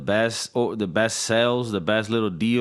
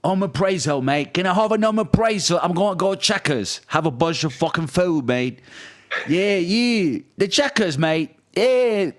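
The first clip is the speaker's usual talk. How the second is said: mock British accent; 7 semitones higher than usual. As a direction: play a cheeky Brit ordering greasy food in a British accent. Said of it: "now that you do that accent".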